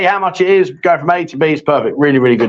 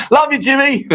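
said through real laughter, higher; laughing affection; 7 semitones higher than usual. Real, not acted. Host to absent friend after teasing him, laughing. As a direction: call it out while cracking up.

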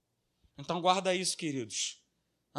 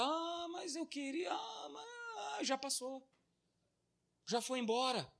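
lament; 8 semitones higher, 8 dB quieter than usual. Retold or imitated. Regretful parent voiced.

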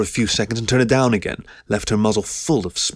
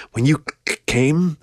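higher voice, stammering on "came"; forced-out, embarrassed; 6 semitones higher than usual. A narrator's reading. Keo finds the word dirty, stammering it out in embarrassment. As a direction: catch on the c, then force "came" out. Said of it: "she had to force the word out".

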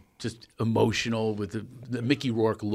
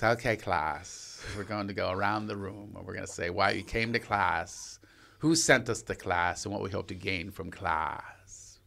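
imitating the teacher; 3 semitones lower, 8 dB quieter than usual. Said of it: "He goes".

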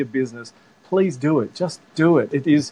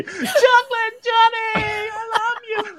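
very high, drawn-out mock scream; long squealed call, stretched out; imitated scream; much slower than usual. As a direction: impersonate an overexcited fan screaming across an airport. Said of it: "he's screaming at me".

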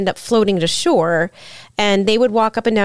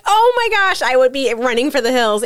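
mock scared; 4 semitones higher than usual. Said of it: "And I'm like".

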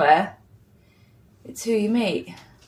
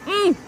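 delighted mmm; 9 semitones higher than usual. Real, not acted. Tasting delicious bread.

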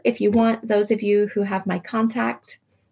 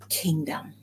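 normal talking voice

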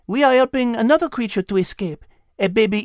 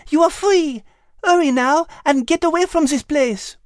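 very high, hurried-sounding French voice; urgent French cat. Acted. Liz frees the cat and urges escape, high and urgent. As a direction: urge her away, high-pitched and excited.

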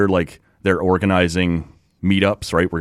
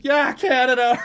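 cheering locals. Retold or imitated. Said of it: "people, like, sticking their heads and going".

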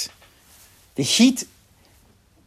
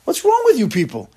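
high, raised-voice tirade; acted angry yelling; 5 semitones higher, 3 dB louder than usual. Moshe yelling at the army over the captive women, angry outburst. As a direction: berate them in heated disbelief. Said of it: "Starts yelling at them".

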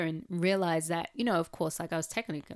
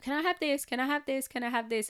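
higher, insistent repeated asking; pestering child; 8 semitones higher, 4 dB louder, faster than usual. Retold or imitated. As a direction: imitate a niece asking on and on. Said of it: "She was constantly saying".

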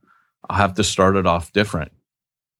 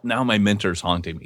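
normal talking voice

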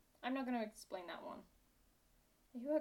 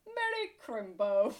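slow, loud festive British greeting; British accent; 8 dB louder, slower than usual. Acted. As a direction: draw out Merry Crimbo.

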